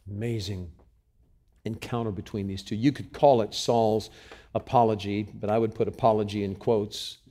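normal talking voice

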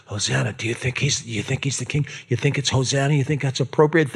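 hushed, hesitant questioning Hosanna; timid quiet mock. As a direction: mumble it softly, unsure if cheering is allowed. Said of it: "They're not quiet".

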